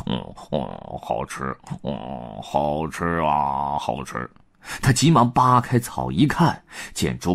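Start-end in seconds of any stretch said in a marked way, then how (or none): 0.1-4.3 sleep talking. Acted. Pigsy talking in his sleep in the grass, low sleepy mumble. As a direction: murmur it drowsily, dreaming of food. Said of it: "还说着梦话呢".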